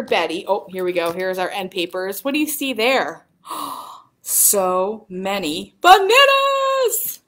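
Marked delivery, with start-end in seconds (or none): 3.5-7.2 gasp, then a slow build to a high cry; amazed excitement. Pretend. Librarian showing children a page of bananas, amazed. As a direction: marvel at it with a high, drawn-out finish. Gasps over banana end papers.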